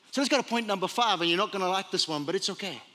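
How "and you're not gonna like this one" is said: ordinary talk, nothing marked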